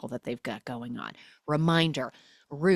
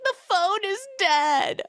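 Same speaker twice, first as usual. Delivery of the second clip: much higher and slower, a dumb whine; high whiny Kayla; 4 dB louder than usual. Retold or imitated. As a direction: play it dim and dramatic. Kayla voiced, then teller retorts.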